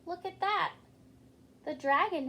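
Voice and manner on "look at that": plain reading voice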